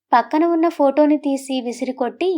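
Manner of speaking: ordinary delivery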